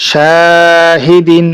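"শাহিদিন" with long held "শা", short endings; stretched reading. Shown as a demonstration. Teacher joining syllables for students, "শা" drawn out. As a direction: sustain the first vowel of "শাহিদিন". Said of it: "টানা পড়ে চলে যাবো".

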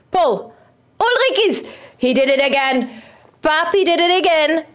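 high, delighted praise; excited cheer. Real, not acted. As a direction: cheer him on gleefully. Celebrating player's goal.

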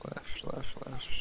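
urgent whispered directions. Real, not acted. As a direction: hiss it quietly. Guiding hunter quietly.

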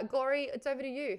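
ordinary delivery

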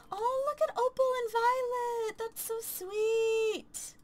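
high, drawn-out cooing; gushing coo. Real, not acted. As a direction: gush like seeing something adorable. Cooing at the game characters.

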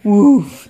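low playful dog bark; low loud woof. Acted. Dog sound for toddler.

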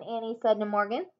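ordinary delivery, nothing marked

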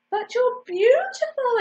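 high, loud exclamation of admiration; exclaiming. A narrator's reading. Swan seeing the duckling step out, exclaiming. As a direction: exclaim it with rising wonder. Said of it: "exclaimed the swan".

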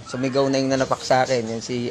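normal talking voice

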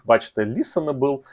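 ordinary delivery

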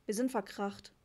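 plain reading voice

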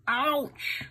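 high squeaky pained ouch; mock ouch. Uncle voicing the hurt dog, high playful ouch. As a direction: cry out like a hurt puppy.

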